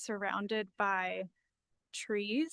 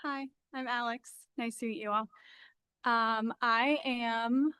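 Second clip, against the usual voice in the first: nervous, shaky voice, louder; 2 semitones higher, 5 dB louder than usual. Real, not acted. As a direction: voice trembling with nerves. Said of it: "sorry if my voice is shaky".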